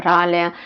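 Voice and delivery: rale with a trilled R; tongue-tip trill opening rale; rolled R. Shown as a demonstration. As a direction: roll your tongue on the R of rale. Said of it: "you will need to roll your R".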